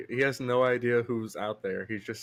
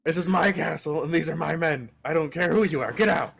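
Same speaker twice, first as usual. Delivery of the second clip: angry; 5 semitones higher, 6 dB louder than usual. Acted. NPC lord ordering intruder out.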